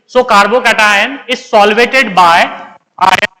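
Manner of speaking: very slow, measured dictation; slow right down for the students; deliberately slow. Shown as a demonstration. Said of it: "मैं बिल्कुल slow बोलूंगा". (बिल्कुल धीरे, लिखवाने के अंदाज़ में)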